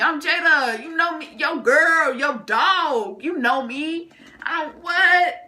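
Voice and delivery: loud, high indignant outburst; acted-out rant, pitch and volume way up; super mad. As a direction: plead loudly in disbelief at being doubted. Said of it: "So now I'm super mad".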